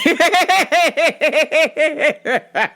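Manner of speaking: high loud cackle; cackling laugh. Real, not acted. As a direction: cackle at yourself. Laughing at own joke.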